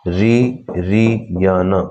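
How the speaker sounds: drawn out very slowly; slow dictation; very slow repeat. Shown as a demonstration. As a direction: stretch it for note-taking. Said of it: "इस तरह से repeat हो रहा है".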